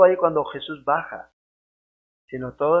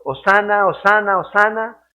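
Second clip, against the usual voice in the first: raised, higher chant of the crowd, mildly acted; crowd cheering; 4 semitones higher, 4 dB louder than usual. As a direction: cheer it like the crowd at the gate, lightly. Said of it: "estaban gritando". (grito de multitud, algo actuado)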